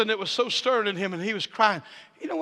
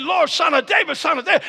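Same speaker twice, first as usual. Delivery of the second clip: higher, louder eager calling; wound up; 8 semitones higher, 7 dB louder than usual. Acted. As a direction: call out, too worked up to stop. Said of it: "Bartimaeus was so wound up".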